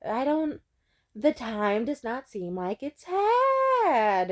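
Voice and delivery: slow, dragging mock; drawn-out voice. Imitating the dad, dragging and slow. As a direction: stretch each word like a lazy cat. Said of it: "he kept extending his voice".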